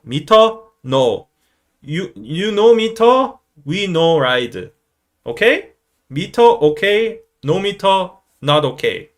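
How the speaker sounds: high, loud, clipped-down simple English; slow, emphatic bargaining in broken English; friends haggling. Retold or imitated. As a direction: spell it out for a taxi driver. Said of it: "my friends would be like".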